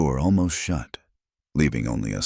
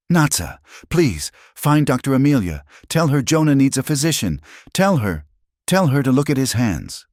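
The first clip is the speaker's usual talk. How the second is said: put-on urgent young woman; 10 semitones higher than usual. A narrator's reading. Ayanna begging her adoptive mother for help, high and urgent. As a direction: beg her to fetch the doctor, urgently. Ayanna urges Natsa to fetch doctor.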